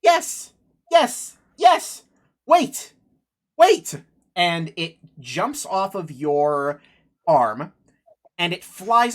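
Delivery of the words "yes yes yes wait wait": high, loud bird chirps; talking bird. Acted. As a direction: chirp each word sharply like an excited bird. Game master answers as the bird.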